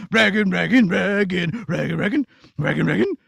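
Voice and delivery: low rhythmic dragon chant. Acted. Dragon chasing the princess, chanting. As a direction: stomp like a hungry dragon.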